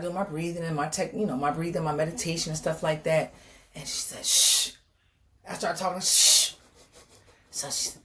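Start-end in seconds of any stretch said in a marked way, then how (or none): 4.3-4.7 long hissed "shh"; firm retold hush; imitated shushing. Imitates doctor shushing her. 6.0-6.5 long hissed "shh"; firm retold hush; imitated shushing. Imitates doctor shushing her again.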